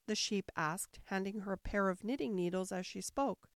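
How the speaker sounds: plain reading voice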